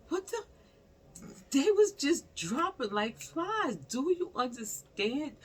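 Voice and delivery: excited disbelief. Real, not acted. High loud disbelief.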